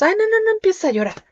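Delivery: put-on high, flustered old man hushing crying kids; flustered grandpa. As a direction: play the dad who can't handle tears, brushing them off. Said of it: "si se ponen a llorar ahora los nietos".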